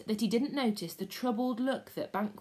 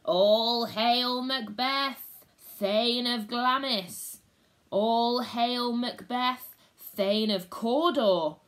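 slow, loud, rasping chant; grating witch voices; 3 semitones higher, 5 dB louder, much slower than usual. Acted. Three witches hailing Macbeth, grating slow chant. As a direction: grate it out like iron on stone. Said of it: "their voices grated like iron on stone".

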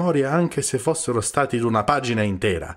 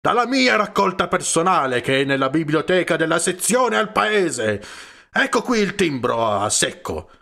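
much higher, louder angry yelling; furious shout; 7 semitones higher, 3 dB louder than usual. A narrator's reading. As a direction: bellow it, outraged. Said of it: "urlò".

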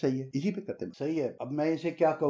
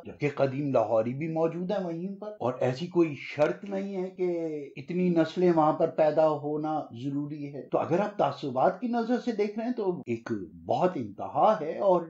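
normal talking voice

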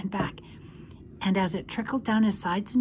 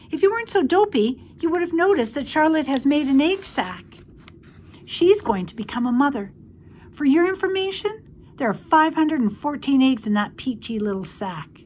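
high, loud little pig voice; high-pitched indignant retort; indignant young pig; 5 semitones higher, 5 dB louder than usual. A narrator's reading.

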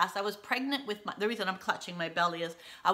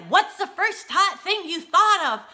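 eager reporter; 10 semitones higher, 9 dB louder than usual. Retold or imitated. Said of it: "And they're like".

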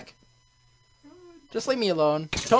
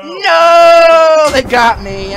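long, loud, high "no" falling away; anguished yell; 10 semitones higher, 14 dB louder, much slower than usual. Real, not acted. Player caught by his enemies, anguished yell. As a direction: wail loudly as you are caught.

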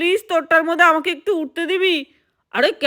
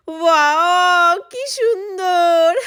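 delighted; 3 semitones higher, much slower than usual. Acted. Delighted at the swimming pool.

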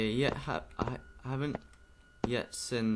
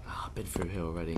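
whispering; 10 semitones lower, 9 dB quieter than usual. Real, not acted. Sneaking through the game.

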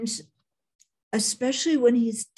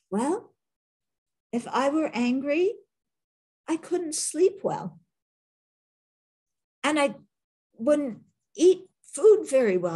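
higher, lightly voiced wise old monk; an easy, unbothered higher voice as the Dalai Lama; gentle Dalai Lama; 7 semitones higher than usual. Telling a reporter he is not angry, lightly voiced higher and calm. His Holiness said.

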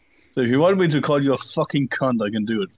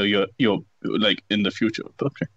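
ordinary delivery

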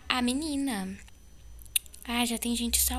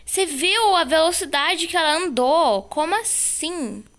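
high, loud amazed cheering; excited; 6 semitones higher, 8 dB louder, slower than usual. Real, not acted. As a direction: cheer in disbelief. Teammate escapes the killer.

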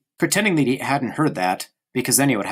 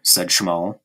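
usual speaking voice

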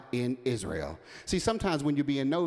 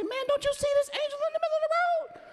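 much higher cartoon donkey voice; talking donkey. Donkey scolding Balaam about the angel, high squeaky voice. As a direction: give the donkey a squeaky indignant voice.

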